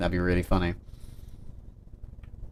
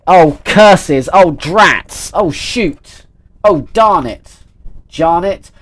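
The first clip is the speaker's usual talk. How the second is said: hammed-up cartoon villain cursing, louder; mock dismay; 4 semitones higher, 6 dB louder, much slower than usual. Streamer realising it's four, theatrically cursing the time. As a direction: play it like a foiled villain stamping a foot.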